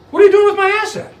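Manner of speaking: high, loud, fast demand; indignant beneficiary. Retold or imitated. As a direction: confront the trustee over your money. Beneficiary demanding an accounting from trustee.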